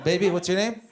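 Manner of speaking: ordinary talk, nothing marked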